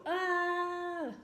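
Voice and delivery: sudden high frustrated yell; frustrated scream. Retold or imitated. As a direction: let out an exasperated cry. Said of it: "it just makes me wanna go".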